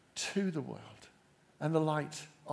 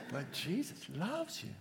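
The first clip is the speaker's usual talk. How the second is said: laughing softly; 9 dB quieter than usual. Real, not acted. Laughs after "hard to believe.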